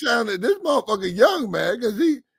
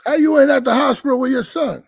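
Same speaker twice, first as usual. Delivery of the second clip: indignant call-out; 5 semitones higher, 6 dB louder than usual. Real, not acted. Calling out the absent father.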